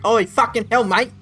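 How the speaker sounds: loud, hammed-up Aussie swearing; Australian accent. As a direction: play an outraged Australian caricature. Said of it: "Or just Australian break?".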